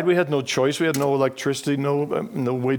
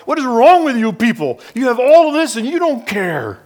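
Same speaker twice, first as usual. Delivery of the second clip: loud, much higher, furious accusation; angry outburst; 9 semitones higher, 6 dB louder than usual. Retold or imitated. Boy on the sidewalk rails at rich shoppers, loud and furious. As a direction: rage at the indifferent passers-by. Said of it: "getting angry at these fancy shoes".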